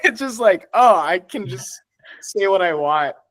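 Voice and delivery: high, giggly delivery; giddy, squeaky amazement; laughing realization. Real, not acted. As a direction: talk through leftover laughter. Still giggling after the rant.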